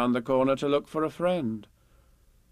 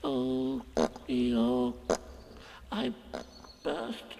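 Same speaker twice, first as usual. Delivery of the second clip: tiny, high, soft, broken squeak; Piglet faltering; 12 semitones higher, 6 dB quieter, much slower than usual. A narrator's reading. Piglet confesses to Eeyore, small halting miserable voice. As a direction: falter with long pauses, fading almost away.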